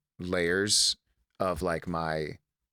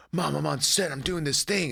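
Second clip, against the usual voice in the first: excited son; 6 semitones higher than usual. Retold or imitated. Excitedly calling mom from set.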